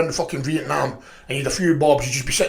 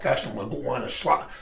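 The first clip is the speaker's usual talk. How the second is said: normal talking voice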